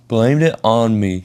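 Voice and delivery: usual speaking voice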